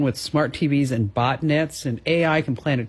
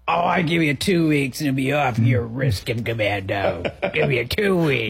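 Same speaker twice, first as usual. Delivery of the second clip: put-on old Louisiana guy. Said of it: "ninety-year-old guy from Louisiana".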